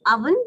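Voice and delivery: OVEN as UV-en, rising; correct oven. Shown as a demonstration. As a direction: model "oven" with a short uh first vowel. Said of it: "or the correct pronunciation".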